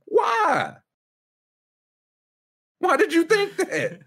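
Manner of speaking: Why? Why did you think that, at full volume; incredulous shout. Real, not acted. Co-host ranting at fans over refs, high incredulous yell. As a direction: raise it to a high, loud yell of disbelief. Mocking fans' expectations, shouting.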